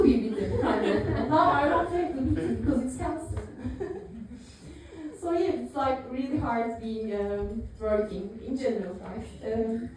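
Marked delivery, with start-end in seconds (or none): none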